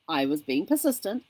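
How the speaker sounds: ordinary talk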